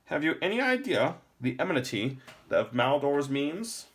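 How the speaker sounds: put-on high, loud old man's voice; a grave old lore master warning; stern old man. Lore master warns Jason.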